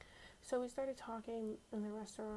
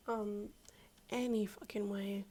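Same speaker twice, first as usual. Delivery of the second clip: exasperated loud aside; 7 dB louder, slower than usual. Real, not acted. Moving on, exasperated.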